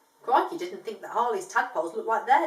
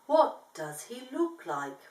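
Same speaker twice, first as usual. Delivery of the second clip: very slow, drowsy voice; sleepy koala asking the emu dad; much slower than usual. Acted. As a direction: drag every word like you're half asleep. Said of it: "she asked slowly".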